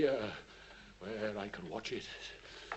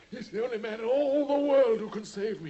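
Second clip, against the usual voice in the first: desperate plea; 10 semitones higher, 9 dB louder than usual. Acted. Holmes begging for his life.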